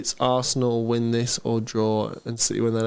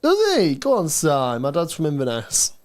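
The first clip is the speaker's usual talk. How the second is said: Scottish accent; 2 semitones higher than usual. Shown as a demonstration. Streamer cheering on Si in Scotland, Scottish accent. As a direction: greet in a put-on Scottish accent. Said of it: "Scottish accent".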